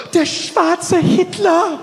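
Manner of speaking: horrified gasp, then high, slow words; petrified. Acted. Counter woman staring at the customer, petrified. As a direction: gasp and say it aghast, drawn out. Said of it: "She was petrified".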